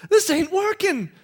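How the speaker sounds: high, exasperated Adam; Adam fed up. Acted. Adam tells God, exasperated and high. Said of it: "and Adam goes".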